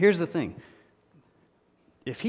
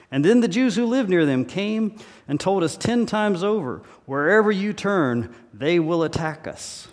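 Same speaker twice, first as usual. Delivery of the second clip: loud, emphatic scripture reading from the pulpit; 3 semitones higher, 5 dB louder than usual. Acted. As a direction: proclaim the threat to the congregation. Reads scripture aloud forcefully.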